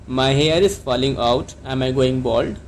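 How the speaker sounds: slow, clear model sentence for learners; careful classroom dictation pace; slow teaching model. Shown as a demonstration. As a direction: say it slowly so students can repeat. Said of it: "तो आप इसको कैसे कहेंगे?".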